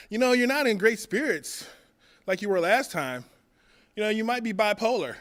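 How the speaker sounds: a hint of a breezy know-it-all doctor; mock doctor. Comic telling the crowd what the Navy doctor told him, mock doctor. Doctor voiced in retold story.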